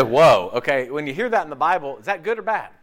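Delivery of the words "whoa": loud woe. Retold or imitated. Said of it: "he said".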